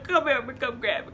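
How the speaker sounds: high, loud sobbing plea; crying for help. Retold or imitated. Burned girl crying for her sister to help her. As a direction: beg through tears. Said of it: "and I'm crying".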